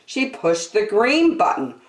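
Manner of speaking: put-on lively read-aloud for little kids; storybook narration. A narrator's reading. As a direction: tell it like a children's storyteller. Reading picture book aloud.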